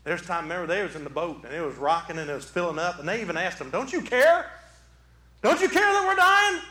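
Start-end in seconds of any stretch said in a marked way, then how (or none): 3.7-6.6 high, loud cry of alarm; desperate plea. Acted. Disciples to Jesus in the rocking boat, desperate plea. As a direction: plead urgently, voice climbing on the question.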